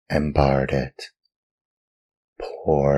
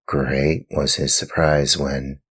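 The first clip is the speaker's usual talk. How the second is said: ordinary delivery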